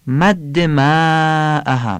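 ماءها with the first vowel held long and high; long madd. Shown as a demonstration. Teacher demonstrates the madd in ماءها. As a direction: stretch the ما in ماءها. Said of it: "مد متصل".

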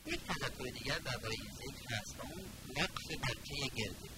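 ordinary talk

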